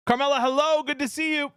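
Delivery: high sing-out hello, pitched well up; bright greeting. Real, not acted. Streamer welcoming Carmela to the stream, high-pitched greeting. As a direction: greet an arrival in a high, happy call.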